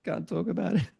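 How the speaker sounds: high, tight mock refusal to talk; a hint of embarrassed deflection; evasive brush-off. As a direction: wave the friends off, half-joking. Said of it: "And I'm like".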